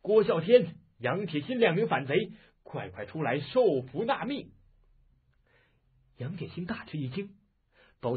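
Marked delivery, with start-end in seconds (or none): 0.0-4.5 loud shout. A narrator's reading. Army officer calls out the fugitives, loud shout. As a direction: bellow the summons across the village. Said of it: "高声叫道".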